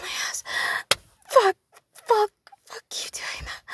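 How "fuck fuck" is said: much louder, higher burst; sudden cry out. Real, not acted. Woman crying out mid-act, sudden and loud. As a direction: explode on the repeated words.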